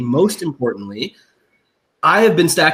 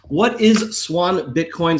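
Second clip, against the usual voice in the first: slow dramatic question; 3 semitones higher, much slower than usual. Acted.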